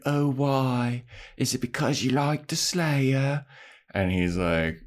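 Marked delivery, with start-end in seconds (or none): 0.1-3.5 drawn-out put-on accent; creepy lady's odd mock accent; Drusilla's accent. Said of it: "And she's like".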